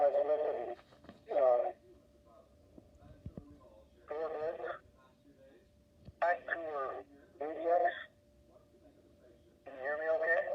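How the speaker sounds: muffled, muzzled phone line. Real, not acted. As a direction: voice sounding through a wall. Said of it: "but it sounds muzzled".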